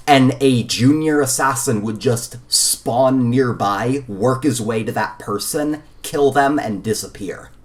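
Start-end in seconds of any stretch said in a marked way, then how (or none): none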